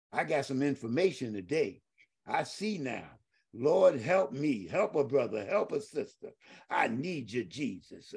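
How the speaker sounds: hushed prayer. Real, not acted. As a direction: pray under your breath. Quiet personal prayer.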